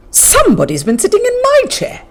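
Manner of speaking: middle-sized bear voice. Acted. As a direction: cry it out, mama bear. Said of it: "cried in a middle-sized voice".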